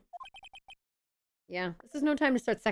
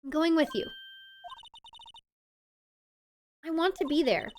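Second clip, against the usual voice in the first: higher, determined plea; insistent sister; 6 semitones higher than usual. Acted. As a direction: insist on going, earnest. Voices Emma insisting.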